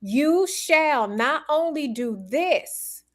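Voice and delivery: slow, high stress on "shall"; emphatic SHALL. Acted. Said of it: "means emphatically".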